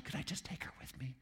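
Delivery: conspiratorial whisper to the wife; whispered plea to the shop owner. Retold or imitated. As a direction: ask in a secret whisper.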